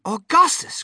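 high, loud cry of a name. A narrator's reading. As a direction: cry out in alarm to stop a boy. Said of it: "cried Mister Wonka".

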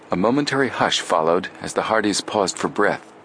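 ordinary delivery

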